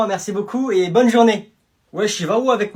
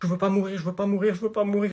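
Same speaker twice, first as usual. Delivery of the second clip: soft, repeated terrified plea; hushed panic chant; frightened muttering; 6 dB quieter, faster than usual. Acted. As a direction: repeat it under your breath in fear. Hiding in fear of intruder.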